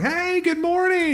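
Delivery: high, drawn-out singsong greeting; cheery mom. Acted. Host mom welcoming guests, high and cheery. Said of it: "It'd just be your mom like".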